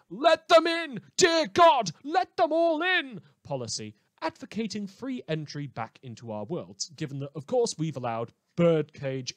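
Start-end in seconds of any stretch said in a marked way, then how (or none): 0.1-3.2 much higher, much louder, hammed-up desperate plea; mock pleading cry. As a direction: wail it like a melodramatic protester begging. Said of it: "encourage a ... policy".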